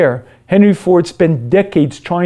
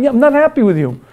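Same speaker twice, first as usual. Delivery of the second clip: much higher, taunting parrot of not happy; mocking echo; 8 semitones higher, faster than usual. Selfish man mockingly echoes his conscience, much higher. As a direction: throw the words back, sneering.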